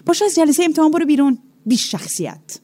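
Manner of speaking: fast, high angry outburst that drops at the insult; mock angry teacher throwing a girl out of the exam; retold yelling teacher. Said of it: "سر من داد زد که".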